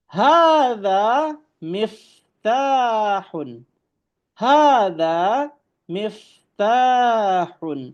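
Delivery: slow, high, careful Arabic recitation of "Hadza babun"; Arabic model reading. Shown as a demonstration. Teacher reading Arabic to students, slow and emphatic. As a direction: drawl out "Hadza babun" for the class to copy. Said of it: "Ini adalah sebuah kunci".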